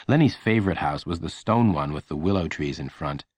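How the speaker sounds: ordinary delivery, nothing marked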